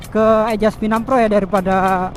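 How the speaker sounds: ordinary talk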